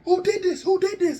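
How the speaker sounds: high, urgent mock panic; alarmed questioning. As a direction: demand answers in a high, strained voice. Alarmed brother demands who did it.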